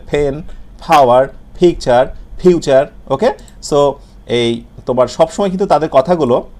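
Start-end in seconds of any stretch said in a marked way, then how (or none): 0.1-2.9 puffed P in "ফেন, ফাওয়ার, পিকচার, ফিউচার"; native English P. Retold or imitated. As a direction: aspirate each first sound of "ফেন, ফাওয়ার, পিকচার, ফিউচার". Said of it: "তারা কিন্তু এভাবে বলে". (ইংরেজিভাষীদের মতো মহাপ্রাণ "ফেন, ফাওয়ার, পিকচার, ফিউচার")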